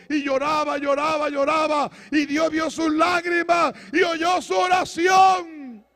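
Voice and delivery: high, loud, mounting sermon cry; full-force preaching. Acted. Preacher telling the congregation of a king's answered prayer, full-force preaching. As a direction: drive the story to a shouted climax.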